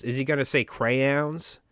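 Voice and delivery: ordinary talk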